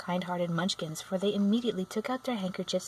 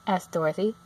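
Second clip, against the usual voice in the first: plain reading voice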